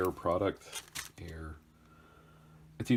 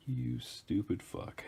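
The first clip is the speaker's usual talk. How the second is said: self-scolding; 5 semitones higher, 5 dB quieter, slower than usual. Real, not acted. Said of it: "That's me talking to me".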